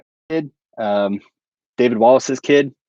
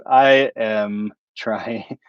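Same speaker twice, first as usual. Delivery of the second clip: very slow, dim-witted Kevin drawl; Kevin impersonation; much slower than usual. Retold or imitated. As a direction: stretch every word like a slow Kevin. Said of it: "do my Kevin impersonation".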